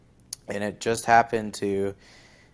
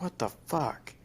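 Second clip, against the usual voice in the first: frustrated; 7 semitones higher, 6 dB quieter than usual. Retold or imitated. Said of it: "I'm getting all frustrated".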